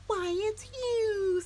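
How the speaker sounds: high, slow, amazed chick voice; surprised little bird. A narrator's reading. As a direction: realize it with delight. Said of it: "said Early Bird".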